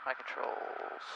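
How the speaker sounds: breathy whisper. Shown as a demonstration. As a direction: whisper the words My controls. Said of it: "My controls for a second".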